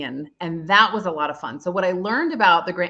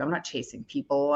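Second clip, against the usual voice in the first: ordinary talk, nothing marked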